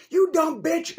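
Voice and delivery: high loud mock yell of an angry man; retold yell. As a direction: bellow it like the guy in the fight. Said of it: "And he's like".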